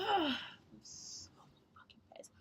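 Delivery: hushed angry whisper; holding back a scream. Real, not acted. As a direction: whisper your fury so nobody wakes. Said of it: "I'm trying not to scream".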